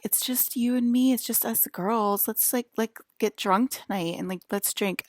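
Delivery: lightly voiced tipsy girlfriend; a hint of a party-girl dragon; girly dragon. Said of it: "And the dragon was like".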